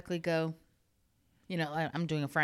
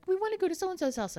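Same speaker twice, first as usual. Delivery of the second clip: high, quick mock-child voice; imitating small kids; 13 semitones higher, 4 dB louder, faster than usual. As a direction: plead like an eager little kid. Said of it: "when my kids used to be small, they'd be like".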